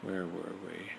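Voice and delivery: soft, low resuming aside; quiet aside. Real, not acted. Reader regathering after a family interruption, soft. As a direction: pick the story back up softly.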